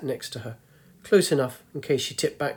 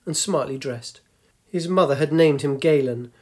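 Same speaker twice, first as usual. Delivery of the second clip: ordinary delivery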